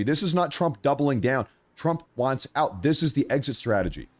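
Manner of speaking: reading aloud. Real, not acted. Streamer reads viewers his post on Iran, reading aloud. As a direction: read it like a prepared statement, pressing each point.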